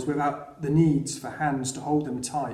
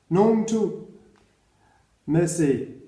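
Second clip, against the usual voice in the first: put-on French accent; slower than usual. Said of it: "but not in a French accent".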